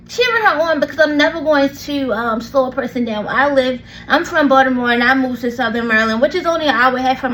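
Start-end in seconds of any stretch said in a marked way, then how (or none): none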